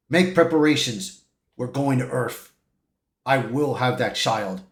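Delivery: low, quiet, menacing overlord; commanding villain. Retold or imitated. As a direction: give orders low and quietly, as a cosmic tyrant. Galactus commands his herald.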